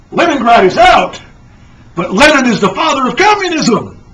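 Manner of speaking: "Leningrad is out" thrown back in shock. Acted. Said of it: "the shocked astronaut replies".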